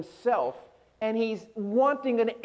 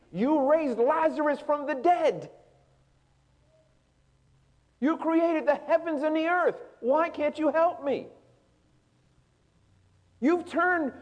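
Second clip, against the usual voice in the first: rising, ever higher and louder desperate cry to God; anguished plea; 9 semitones higher, 3 dB louder than usual. Acted. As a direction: build the pleading until it breaks into a cry. Preacher voices Jesus pleading God.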